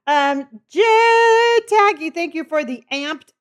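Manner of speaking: thrilled shout-out to a viewer; excited high call. Real, not acted. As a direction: stretch the name out in a delighted squeal.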